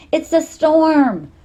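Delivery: slow, loud, high urgent shout; girl shouting a warning over the phone. Acted. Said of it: "Nim shouted".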